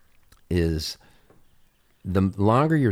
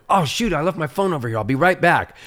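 higher, put-on innocent excuse voice; fake excuse; 8 semitones higher, 3 dB louder than usual. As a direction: sell the lost phone story lightly. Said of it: "I'm really good at going".

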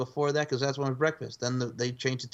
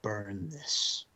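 Al Pacino; 4 semitones lower, 5 dB quieter than usual. Retold or imitated. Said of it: "Al Pacino goes".

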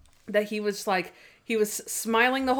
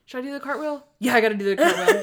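mock giddy Monk; 4 semitones higher, 4 dB louder, faster than usual. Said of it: "And he's like".

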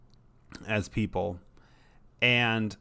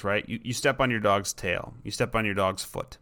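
ordinary talk

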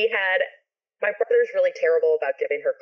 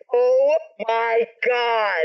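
higher, very slow, amazed exclamation; drawn-out astonishment; 5 semitones higher, 3 dB louder, much slower than usual. Retold or imitated. Reacting to news about the helicopter ride, drawn-out astonishment. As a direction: stretch every word out in amazement.

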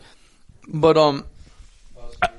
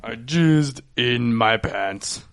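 slow deadpan; 2 semitones lower, 3 dB quieter, much slower than usual. Real, not acted.

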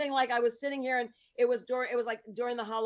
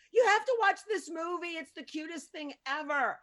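gushing recommendation; 6 semitones higher than usual. Retold or imitated. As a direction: gush to a friend about it. Retelling her gushing phone call.

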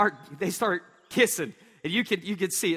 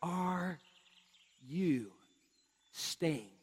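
slow soft pleading; 2 semitones lower, 5 dB quieter, slower than usual. Retold or imitated. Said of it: "they say".

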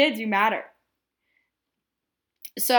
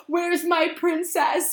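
high, slow, hammed-up plea; melodramatic whine; 7 semitones higher, much slower than usual. Fan hamming up her envy of a movie daughter, slow high whine. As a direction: stretch it out like a pouting child. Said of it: "I got very melodramatic".

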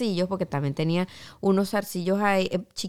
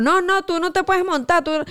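high, insistent, a bit louder; mock firm refusal; 10 semitones higher, 4 dB louder than usual. Driver refusing to let her board: high insistent no. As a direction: imitate the driver turning her away, pitched well up.

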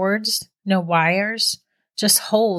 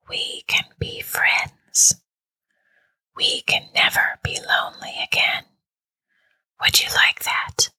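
hushed whisper. A narrator's reading. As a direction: draw out each hushed syllable gently. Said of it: "the whisper asked".

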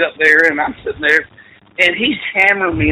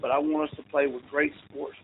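solemn hushed prayer; 13 dB quieter than usual. Retold or imitated. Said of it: "he starts saying the prayer".

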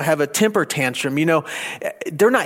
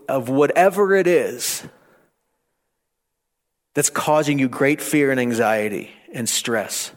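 ordinary talk

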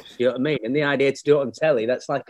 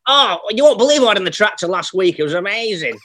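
higher, louder mock kid's voice; boastful farm kid; 6 semitones higher, 6 dB louder than usual. Farm kid bragging about the tractor, mock excited voice. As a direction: brag like an excited young farm lad. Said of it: "some twelve-year-olds driving you across the".

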